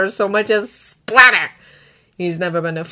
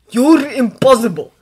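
raised, louder exasperation; panicked, upset; 4 semitones higher, 7 dB louder than usual. A narrator's reading. Dragon to the coughing boy he rescued, panicked and upset. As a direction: scold out of panic. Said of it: "like he's panicked and upset".

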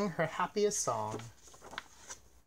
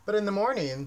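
plain reading voice

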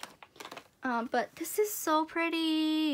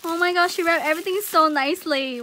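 louder, gushing admiration; excited amazement; 4 semitones higher, 7 dB louder than usual. Real, not acted. Admiring how a friend wrapped everything, excited amazement. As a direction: burst out, amazed and delighted.

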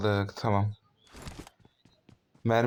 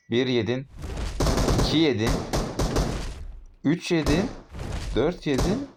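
rising exasperation; 4 semitones higher than usual. Real, not acted. Counting hits on enemy, exasperated.

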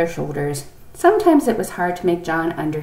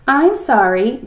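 higher, louder polite apology; 6 semitones higher, 6 dB louder, slower than usual. A narrator's reading. Boy apologising to the doctor, politely. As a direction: apologise like a well-mannered child. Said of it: "John said politely".

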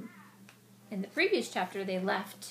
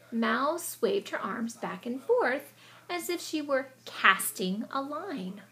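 story narration; 3 semitones higher, 5 dB louder, slower than usual. A narrator's reading. Expressive read-aloud narration.

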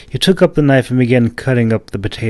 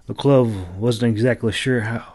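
usual speaking voice

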